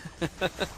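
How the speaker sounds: short, quiet laugh; soft chuckle. Real, not acted. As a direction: chuckle softly at your partner's remark. Amused by co-caster's doubtful remark.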